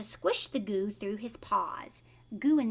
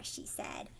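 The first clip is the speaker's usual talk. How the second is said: plain reading voice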